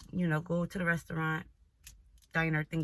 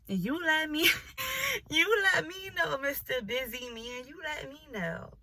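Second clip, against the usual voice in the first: higher, giggling mock-sweet dismissal; laughing brush-off; 8 semitones higher than usual.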